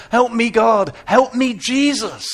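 shouted prayer, high and scared; scared cry for help. Acted. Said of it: "I cried out".

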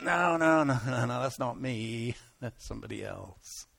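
mock reluctant no-no-no, sinking low; backing away. Believer dodging what God tells them, quietly backing off. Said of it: "but we're afraid of the fire".

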